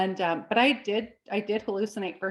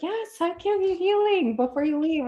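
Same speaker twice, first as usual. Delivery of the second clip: Shelley; 9 semitones higher than usual. Retold or imitated. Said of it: "And Shelley was like".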